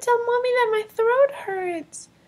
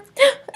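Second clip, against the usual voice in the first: startled gasp; 6 semitones higher, 5 dB louder than usual. Real, not acted. Sister startled by sudden approach.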